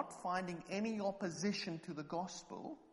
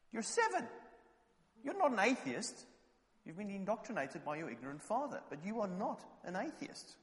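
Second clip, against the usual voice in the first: indignant retort; 6 semitones higher than usual. Retold or imitated. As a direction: scold the child for parroting his father. Indignant retort to seven-year-old.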